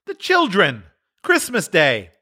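calm his wife; gently reminding husband. A narrator's reading. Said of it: "said Bob".

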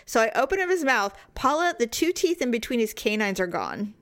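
usual speaking voice

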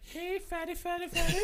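high squeaky taunting chef voice; mock chef. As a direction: tease him in a squeaky high voice. Mocking chef's voice.

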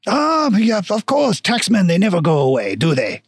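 knowing mock-French dwarf; bad French accent. Dwarf shopkeeper grumbles about taxmen, bad French accent. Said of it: "very shitty French accent".

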